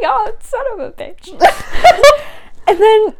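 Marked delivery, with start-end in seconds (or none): 0.0-1.3 high laughing exclamation. Retold or imitated. Viewer's own reaction, laughing. 1.3-2.6 shrieking giggle; high laugh. Real, not acted. Laughs at the scene.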